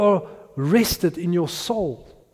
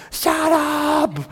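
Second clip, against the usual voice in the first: mock shout; 10 semitones higher than usual. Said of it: "I just hear my wife shouting".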